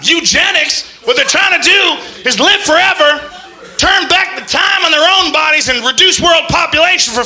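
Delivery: high, loud shouting rant; Alex Jones rant. Retold or imitated. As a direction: rant like a frantic radio host. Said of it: "Alex Jones is a conspiracy theorist".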